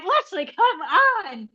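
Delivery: high, slow, laughing protest; laughing plea. Retold or imitated. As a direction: beg her to stop through laughter. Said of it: "my husband's like".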